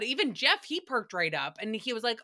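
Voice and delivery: usual speaking voice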